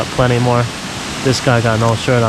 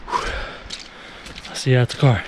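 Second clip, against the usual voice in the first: normal talking voice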